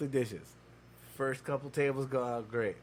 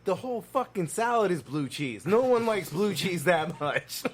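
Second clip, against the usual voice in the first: exasperated retort; 6 semitones higher, 4 dB louder than usual. Retold or imitated. Arguing retold at coworker.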